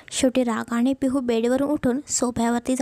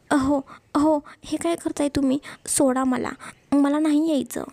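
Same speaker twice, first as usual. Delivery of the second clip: plain reading voice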